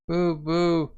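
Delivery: high, drawn-out boo-hoo; mock toddler crying. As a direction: imitate a little child wailing. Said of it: "he's crying".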